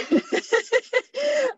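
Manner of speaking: short high burst of real laughter. Real, not acted. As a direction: laugh it off lightly at yourself. Laughs at own confession.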